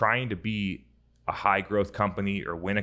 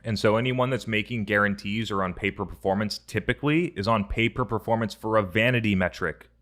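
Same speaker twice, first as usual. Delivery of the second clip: ordinary delivery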